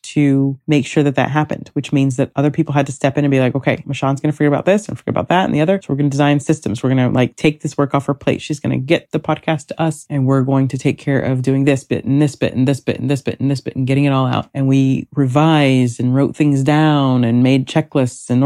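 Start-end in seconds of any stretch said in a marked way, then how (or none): 3.6-14.5 rapid team voice. Retold or imitated. Voices her team planning systems.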